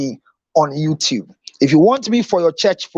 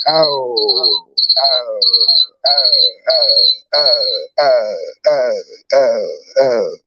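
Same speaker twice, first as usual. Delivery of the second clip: low, overcome oh repeated; hammed-up overwhelmed moaning; mock sobbing; 11 semitones lower, much slower than usual. Overwhelmed by the compliment again.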